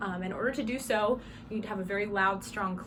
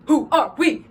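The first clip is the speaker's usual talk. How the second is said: WHO ARE WE shouted as three separate punched words; emphatic statement; 7 semitones higher, 13 dB louder, slower than usual. Shown as a demonstration. Drum major calls to the band, emphatic shouted statement. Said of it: "look at each other and you go".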